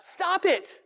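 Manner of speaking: curt dismissive order; blunt counselor command. Retold or imitated. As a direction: snap it off like an impatient counselor. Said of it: "he's playing the part of a counselor".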